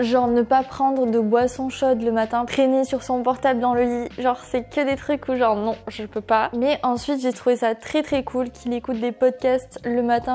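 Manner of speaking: ordinary talk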